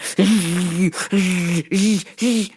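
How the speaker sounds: mock grunts and hisses of squeezing hard; imitated straining. Grappler imitating his opponent squeezing the choke, exaggerated straining noises. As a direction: act out a long, effortful choke squeeze. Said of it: "I hear him just squeezing with all his might".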